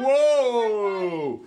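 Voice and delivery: loud, high, drawn-out whoa; high, awestruck exclamation; amazed whoa. Real, not acted. Amazed at the gingerbread's size.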